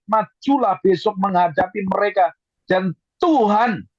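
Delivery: high-pitched, forceful Bible proclamation; emphatic scripture reading. Acted. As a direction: proclaim the promise to the whole congregation, pitch lifted. Reads scripture at full force.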